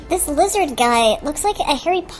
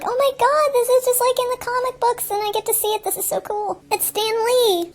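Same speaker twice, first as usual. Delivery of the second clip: put-on much higher, gushing kid voice; excited kid; 9 semitones higher than usual. A kid in the theater seeing Stan Lee, in a much higher, gushing voice. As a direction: gush like a thrilled kid seeing the comic come alive. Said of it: "some kids would be like".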